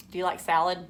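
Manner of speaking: ordinary talk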